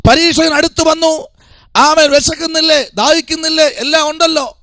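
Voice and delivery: high-pitched, sustained pulpit delivery; impassioned preaching. Real, not acted. Preacher urges congregation to fast round the clock, impassioned preaching. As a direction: drive each phrase home on a high, held note.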